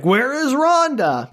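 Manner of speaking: high, drawn-out hammed-up search call; mock calling out. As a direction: call slowly for someone lost, playfully. Townspeople searching, acted out.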